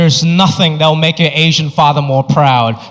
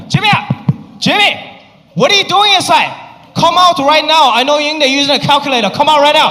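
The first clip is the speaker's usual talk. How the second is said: a father bellowing through the door at his son; screaming dad; 9 semitones higher than usual. Retold or imitated. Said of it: "And he was screaming".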